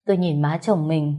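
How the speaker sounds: usual speaking voice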